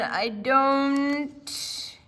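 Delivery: loud cut-off refusal; gruff refusal. Acted. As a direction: break off mid-protest, loud. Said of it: "said the man".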